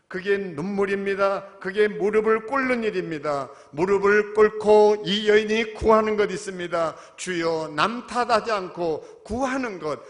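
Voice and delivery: loud, building sermon delivery; fiery preaching; preaching at full force. Acted. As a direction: preach it hard to the congregation.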